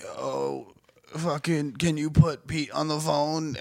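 slow, drawn-out mock invalid; exaggerated sick voice. Kid from work calling in to the boss, exaggerated sick voice. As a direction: imitate a co-worker laying the illness on thick.

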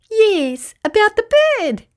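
high, teasing rabbit voice; amused rabbit. A narrator's reading. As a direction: tease him gleefully. Rabbit mocking.

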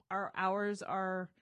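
ordinary delivery

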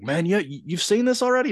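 high retold disbelief; surprised question. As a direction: rise high in mock amazement. Retelling his surprise at son.

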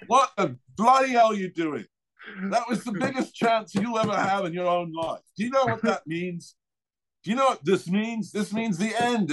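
high-pitched ranting English manager; exasperated put-on English accent. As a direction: imitate an outraged Englishman going off on a rant. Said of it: "he's the English guy".

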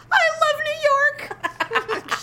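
high, breathy New York swoon; Samantha impression. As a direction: gush it like Samantha. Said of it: "She's like".

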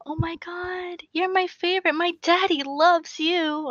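put-on high-pitched gushing kid; high little-girl voice, stretching out the last words; adoring little girl. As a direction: imitate a thrilled small child, voice way up. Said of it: "I had a little girl run up to me".

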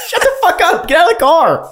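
high loud mocking yell; high loud outraged yell; mock shout. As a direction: yell it at the driver in disbelief. Retold outrage at driver.

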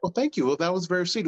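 a guy thanking a stranger, prim and overly sweet; sweet polite guy. Pretend. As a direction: gush a little too sweetly. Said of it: "Your purpose is like".

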